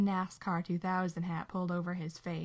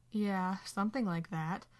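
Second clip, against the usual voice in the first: usual speaking voice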